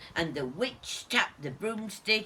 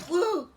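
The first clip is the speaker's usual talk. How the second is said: relieved whew; 10 semitones higher, 5 dB louder than usual. Acted. Dog relieved after danger.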